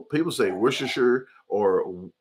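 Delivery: usual speaking voice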